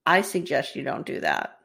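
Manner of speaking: low, soft warning in a shaky attempt at British; British accent, understated and low. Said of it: "wish I could do a British accent".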